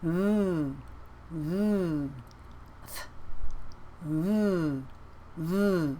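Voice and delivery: "V" buzzing with vibration; voiced V. Shown as a demonstration. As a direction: let "V" vibrate in the throat. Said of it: "but it has a vibration".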